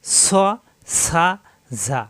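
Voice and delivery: স, সা, ঝা each with a long hissing onset; separate, drawn-out sibilant syllables স, সা, ঝা; whistled sibilants. Shown as a demonstration. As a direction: let air whistle out on স, সা, ঝা. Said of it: "শীষ দিয়ে পড়া".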